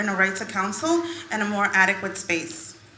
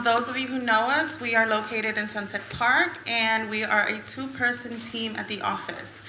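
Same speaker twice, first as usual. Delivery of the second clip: ordinary talk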